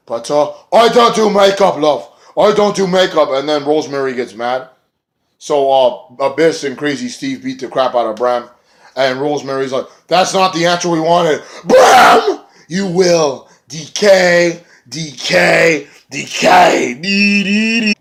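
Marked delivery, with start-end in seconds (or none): 0.1-3.3 raised, louder put-on snooty voice; lightly hammed brush-off, love; mock Bram voice. 10.1-17.9 higher, louder wailing threat; hammed-up creepy curse. Rosemary's creepy threat acted out.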